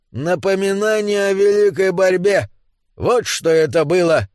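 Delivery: high-pitched rally slogan; rousing proclamation. Acted. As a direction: proclaim it to the comrades. Dwarf proclaims the great struggle. (торжественный митинговый возглас)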